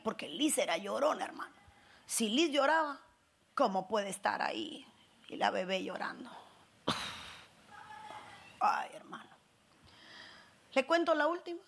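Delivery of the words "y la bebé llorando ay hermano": low whispered sigh; whispered lament. Retold or imitated. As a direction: whisper it worn out and ashamed. Whispered lament about crying baby.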